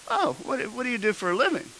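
high-pitched imitation of a curious clerk. Clerk making small talk with a customer, high-pitched imitation. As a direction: lift the question up high. Voices clerk's casual question.

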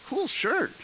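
high-pitched imitation of a clerk; chirpy clerk. Clerk admiring the shirt at the register, high-pitched imitation. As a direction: put the clerk up high, lightly. Voices the store clerk's compliment.

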